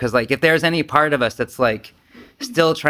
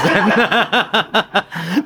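laughter; 7 semitones higher than usual. Real, not acted. Laughing at own vulnerability.

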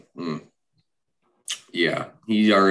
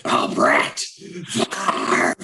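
very slow, much higher, breathy mock wrestling promoter; Vince McMahon impression; 13 semitones higher, much slower than usual. Cohost offers a Vince McMahon instead, drawn-out dramatic delivery. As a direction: draw the name out in a hushed, dramatic promoter voice. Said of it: "I can do a Vince McMahon".

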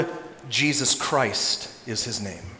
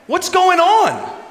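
high, slow, panicked question; alarmed cry; 6 dB louder, much slower than usual. Acted. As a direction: shake him awake in fear. Disciples waking Jesus.

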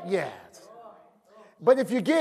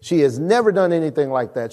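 ordinary talk

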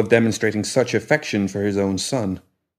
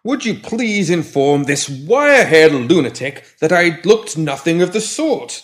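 put-on higher, slow and prim old man; testy old gentleman; 7 semitones higher, 4 dB louder, slower than usual. A narrator's reading. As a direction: huff it out like a fussy old gent. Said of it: "the pram retorted testily".

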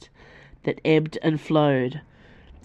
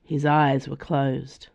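ordinary delivery, nothing marked

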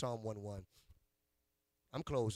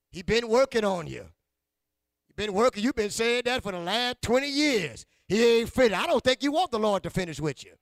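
loud, high preaching shout; preacher shouting a joke at the congregation; preaching at full force; 8 semitones higher, 13 dB louder than usual. Acted. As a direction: lift it into a roaring tease. Preacher teases congregation, rising.

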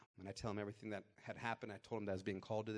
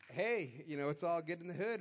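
mock cheerful; 8 semitones higher, 6 dB louder than usual. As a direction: play up the all-is-well feeling. Mock-cheerful imagined thought.

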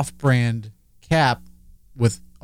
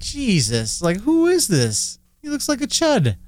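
incredulous scorn; 9 semitones higher than usual. Retold or imitated. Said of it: "I was just like".

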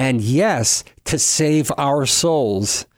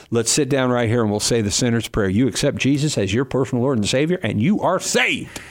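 put-on Southern accent. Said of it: "You used to save people with a real Southern accent".

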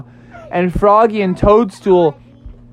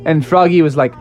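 usual speaking voice

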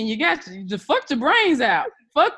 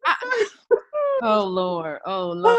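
laughing overwhelmed; 6 semitones higher, slower than usual. Real, not acted. Overcome with laughter.

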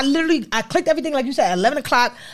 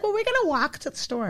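higher, softer voice, WALK as "wahk"; imitated Maine girl; 8 semitones higher, 6 dB quieter than usual. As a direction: mimic a Maine accent. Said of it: "It's like".

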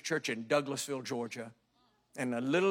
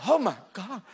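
scared gasp; 4 semitones higher, 6 dB louder than usual. Retold or imitated. Sudden fear at the fall.

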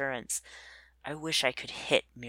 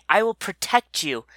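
higher, louder earnest promise; loud vow; 6 semitones higher, 7 dB louder than usual. A narrator's reading. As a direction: declare it as a protector. Mirin Anue declares protection.

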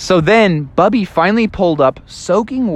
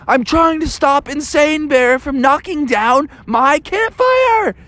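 put-on higher, strained kid voice; high Bubby voice; 9 semitones higher than usual. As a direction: explain high while wrestling the bear. Bubby voiced while wrestling.

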